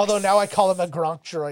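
ordinary delivery

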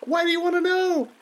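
put-on high suspicious child voice. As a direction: ask like a wary kid. Drowning child.

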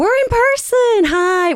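high squeal of delight; excited. Real, not acted. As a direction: squeal it, thrilled. Excited in-person greeting.

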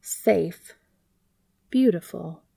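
plain reading voice

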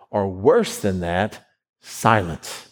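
ordinary delivery

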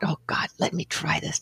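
whispered thought to herself; excited private thought. Retold or imitated. Reading a book, she thinks to herself, whispered. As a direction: breathe it like a secret hope. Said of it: "and I thought".

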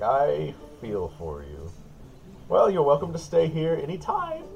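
lightly voiced old innkeeper, drawn-out commiseration; sympathetic older innkeeper. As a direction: offer comfort in an old innkeeper's character voice.

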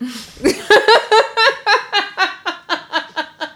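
high falling cackle; big laugh. Real, not acted. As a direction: burst out laughing at it. Laughing at own story.

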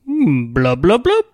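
burbling fish mouth noises; fishy blub noises. Retold or imitated. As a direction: gurgle like a fish in a tank. Fish-man Edric voiced.

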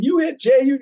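the crowd accuses Biff, voiced shrilly; imitating accusing crowd. Crowd accuses Biff as he runs from the car, high alarmed cry. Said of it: "everybody's saying".

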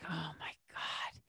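slow, drawn-out whispered disbelief; exasperated whisper. Retold or imitated. Shop girl facing another cleanup, exasperated whisper. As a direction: whisper it slowly, imitating silent dismay. Acts girl's silent exasperated reaction.

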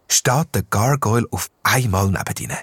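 plain reading voice

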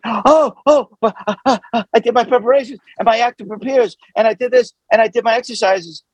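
high, stammering mock panic; flustered panic. Prepared actor protests switching parts back, flustered panic.

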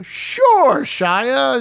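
high, loud, drawn-out disbelief; sing-song sarcastic agreement, starting way up high; mock agreement. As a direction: humor them with an exaggerated yes. Disbelieving mock reply to email.

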